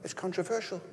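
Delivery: soft, high fretting voice; hushed, high-pitched mock worry; mock dismay. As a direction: imitate timid folk fussing under their breath. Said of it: "You can imagine some folk going".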